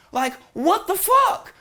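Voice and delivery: high, slow disbelief; incredulous. Real, not acted. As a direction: stretch it out in disbelief. Ranting about climate cuts.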